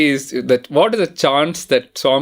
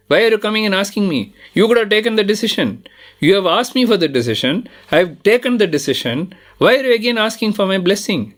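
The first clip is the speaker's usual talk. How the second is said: mild stern rebuke; 7 semitones higher than usual. Retold or imitated. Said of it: "Again, he's very angry".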